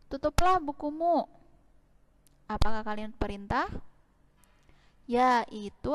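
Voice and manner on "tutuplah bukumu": "tutuplah bukumu" said higher and louder as a firm command; rising command tone. Shown as a demonstration. Teacher demonstrating a command to pupils, higher and louder. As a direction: give the order "tutuplah bukumu" with a raised, rising pitch. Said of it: "intonasinya naik".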